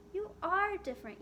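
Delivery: high, firm mother voice. A narrator's reading. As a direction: tell her firmly like a mom.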